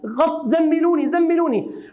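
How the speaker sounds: high, urgent plea to be covered; terrified pleading. Retold or imitated. Muhammad begs his wife to cover him, terrified. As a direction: beg to be wrapped up, shaking with fear. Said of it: "And he was shivering".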